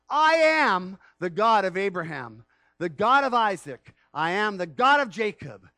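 loud, solemn divine declaration; preacher voicing God at full force; booming proclamation. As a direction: boom out the name of God. God's self-declaration preached.

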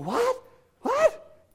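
shocked squeak, jumping very high; stunned what. Retold or imitated. Teen given the car keys by dad, shocked high squeak. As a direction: blurt it out in disbelief, voice shooting up.

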